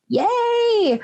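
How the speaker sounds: high, bright little yay; excited cheer. Real, not acted. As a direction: cheer it like a win. Cheering the fix.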